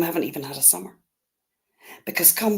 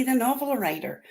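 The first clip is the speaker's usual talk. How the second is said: normal talking voice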